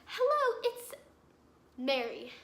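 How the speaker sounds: sing-song parody of the scammer greeting; mocking Mary. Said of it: "She goes".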